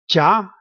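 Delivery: jia gliding upward; rising jia, like a question; second tone. Shown as a demonstration. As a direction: say jia with the pitch climbing to the end. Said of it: "these are four different tones".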